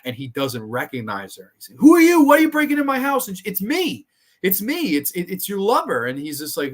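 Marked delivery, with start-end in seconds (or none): 1.8-3.3 loud, high-pitched challenge to an intruder; retold alarmed yell, pitched way up; mock outraged shout. Retold angry confrontation of intruder. 3.4-6.0 high-pitched imitation of pleading; acted-out plea in a raised voice; mock desperate plea. Retold desperate plea to lover.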